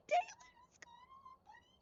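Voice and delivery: very high, excited squeak; really squeaky. Real, not acted. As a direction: squeal a delighted greeting. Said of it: "I'm getting really squeaky".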